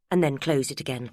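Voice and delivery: ordinary delivery, nothing marked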